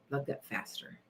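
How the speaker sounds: a secret whispered mantra. Shown as a demonstration. Whispering the mantra to her viewers, hushed. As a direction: breathe the words out with no voice, very soft.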